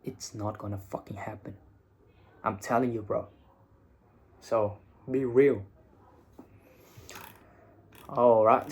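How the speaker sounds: ordinary talk, nothing marked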